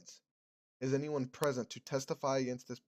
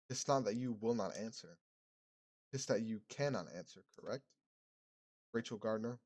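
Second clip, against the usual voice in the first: reverend's calm questioning; 2 semitones lower than usual. Acted. Reverend accuses Rachel.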